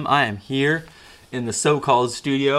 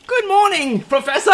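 put-on pitched way up, eager young Harry; high student voice; 4 dB louder than usual. Harry greeting his professor in a high eager voice. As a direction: squeak out a keen pupil's greeting.

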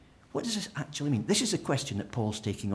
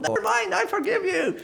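very high, louder, breezy; mock God voice; 7 dB louder than usual. God answering the sinner, high and breezy. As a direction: play God as a cheery pushover. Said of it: "God's reply".

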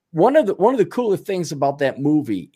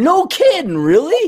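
sudden high, louder disbelief; surprised; 5 dB louder than usual. Real, not acted. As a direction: jump up in genuine surprise. Surprise at Christopher Lloyd news.

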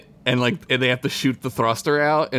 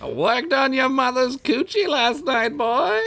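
put-on old wise man voice; 12 semitones higher than usual. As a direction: play a fussy old sage. Continuing the Reese's bit.

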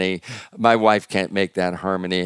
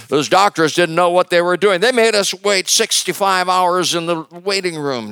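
higher, louder mock outrage at doctors; indignant blame; 9 semitones higher, 4 dB louder than usual. Grieving family blaming the hospital doctors, indignant and louder. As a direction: vent at the doctors, hammed up a little.

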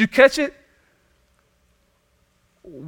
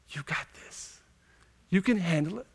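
breathy whispered voice, much softer; whispered lie; 11 dB quieter than usual. Acted. Pastor voicing the lie of self-sufficiency, whispering. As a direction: whisper it like a tempting voice in your ear. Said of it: "it whispers in our ear".

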